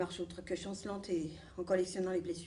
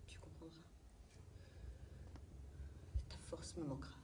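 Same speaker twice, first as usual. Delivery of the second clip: tender whisper; 5 semitones lower, 14 dB quieter than usual. Acted. Mother telling Gould she will miss him, tender whisper. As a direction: whisper it like a secret goodbye.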